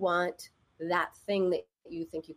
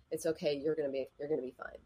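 low, muted, dismissive comfort; unhelpful reassurance; 6 semitones lower, 7 dB quieter, faster than usual. Retold or imitated. Woman talking to herself in second person, dismissive. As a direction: drop the pitch and toss the comfort away. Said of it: "I think she said it like".

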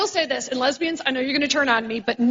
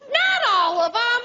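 indignant mom; 6 semitones higher than usual. Retold or imitated. Daughter teases, mom snaps back in defense, mock indignant. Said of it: "Here's her defense".

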